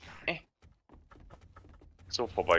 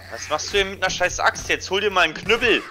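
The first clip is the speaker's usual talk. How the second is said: loud teasing shout; 9 semitones higher, 4 dB louder than usual. Real, not acted. Teasing friend while fighting.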